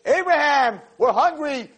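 high, hammed-up plea; begging travelers. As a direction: beg like a hungry wanderer. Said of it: "They should have been on the floor saying".

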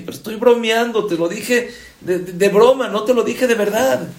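high, loud protest of innocence; acted protest. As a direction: protest loudly that it was only teasing. Protesting it was only a joke.

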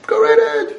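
high mocking go right ahead; sarcastic. Stern father mocking the child who leaves, sarcastic. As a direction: toss it off with a sneer.

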